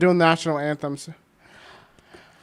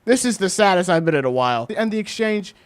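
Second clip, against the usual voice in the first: ordinary talk, nothing marked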